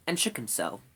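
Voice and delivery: usual speaking voice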